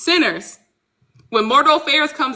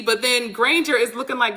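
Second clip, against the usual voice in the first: normal talking voice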